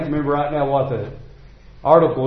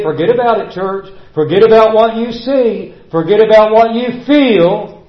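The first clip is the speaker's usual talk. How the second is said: full-force preaching; 4 semitones higher, 4 dB louder than usual. Acted. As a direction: hammer the verses home to the church.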